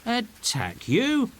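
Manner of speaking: high, slow amused disbelief; drawn-out surprised question. A narrator's reading. Stefano answering Caliban with high slow surprise. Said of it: "says Stefano".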